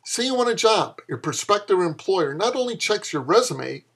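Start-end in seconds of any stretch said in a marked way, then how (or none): none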